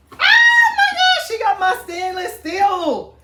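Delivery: high, loud, laughing cries of joy; ecstatic squeal. Real, not acted. Thrilled vlogger opening the steel set, squealing in delight. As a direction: scream it like the best gift ever.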